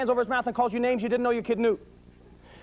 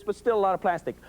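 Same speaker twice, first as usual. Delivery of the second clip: normal talking voice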